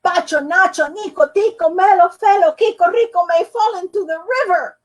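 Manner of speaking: loud high yell of the whole name; boy yelling the full name at the carpenter; top of his lungs. Acted. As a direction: bellow every name. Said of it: "yelled at the top of his lungs".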